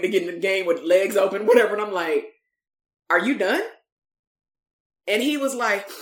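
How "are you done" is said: unmoved coach, retold deadpan; no affect. Coach to crying kid, no affect. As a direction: ask it with no emotion at all. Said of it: "this is exactly how I'm sounding".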